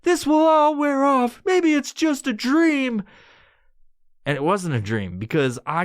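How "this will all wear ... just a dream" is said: raised, pleading voice; high hopeful whine. Retold or imitated. Lying awake hoping it wears off, high plea. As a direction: talk yourself toward sleep. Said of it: "I was like".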